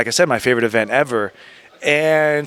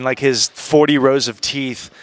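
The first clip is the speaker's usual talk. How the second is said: ordinary talk, nothing marked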